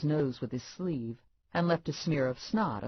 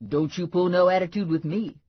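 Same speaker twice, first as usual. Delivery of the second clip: sassy Lula voice; 4 semitones higher, 6 dB louder than usual. A narrator's reading. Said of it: "she was saying".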